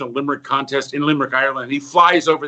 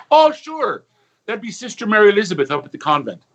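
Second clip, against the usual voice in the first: Irish bartender; 5 semitones higher than usual. Acted. Bartender names the nun.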